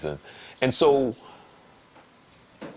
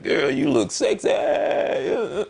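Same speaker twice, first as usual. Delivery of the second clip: high, whooping mock admiration; excited catcall; 8 semitones higher, slower than usual. Man imagining a woman walking in dressed up, whooping admiration. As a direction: call it out with a rising, hammed-up whoop. Acting out admiring her outfit.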